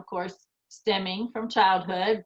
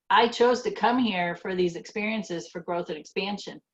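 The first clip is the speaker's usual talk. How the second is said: ordinary talk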